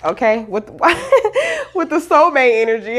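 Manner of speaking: giggling through the words; laughing. Real, not acted. Laughing at lyric.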